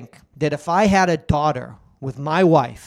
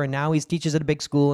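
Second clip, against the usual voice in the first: ordinary talk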